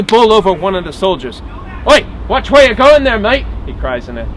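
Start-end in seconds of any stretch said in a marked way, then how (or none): 1.9-3.5 loud, high Cockney cry; thick Cockney. Acted. Soldier nearly bowled over by marshal, crying out in Cockney. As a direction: cry out indignantly in a Cockney accent. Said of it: "thick Cockney dialect".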